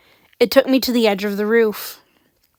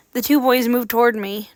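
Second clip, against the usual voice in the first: plain reading voice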